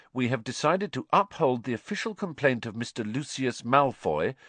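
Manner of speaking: stiff, official letter reading; reading a letter aloud. A narrator's reading. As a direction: read it like an official notice. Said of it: "read aloud".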